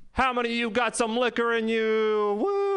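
high rising hype call to the crowd; hype-woman shout. Retold or imitated. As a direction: whip the crowd up, ending on a squealed woo. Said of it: "[name], she goes".

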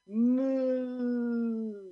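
loud, high, long moo; farmer mooing. Acted. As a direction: moo in joyful surrender. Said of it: "says the farmer".

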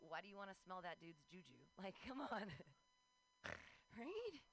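ordinary delivery

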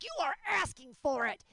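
high-pitched mock outrage at a pet; hammed-up telling-off; mock scolding. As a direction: scold the cat with playful indignation. Mock scolding of her cat.

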